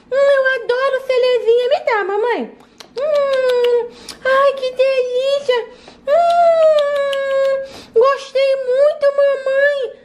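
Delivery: put-on high, lisping toddler voice; little kid voice. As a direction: play a small girl savoring a treat. Child character Alana voiced.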